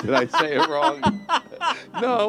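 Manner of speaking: high, loud, laughing protest. Real, not acted. Host laughing over his own botched word, flustered. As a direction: crack up while asking, voice climbing high.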